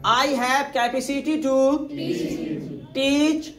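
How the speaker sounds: slow, clear model sentence. Shown as a demonstration. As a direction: dictate it slowly. (धीरे धीरे बोलो)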